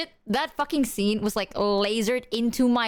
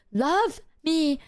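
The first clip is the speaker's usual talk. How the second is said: mock Meredith plea; 6 semitones higher than usual. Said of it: "that one scene where she's like".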